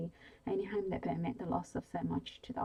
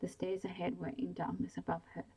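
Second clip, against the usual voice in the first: ordinary delivery, nothing marked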